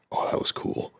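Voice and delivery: soft, whispered awe; impressed whisper. Retold or imitated. Moviegoer reacting as Batman catches the coin, impressed whisper. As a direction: whisper a retold reaction under your breath.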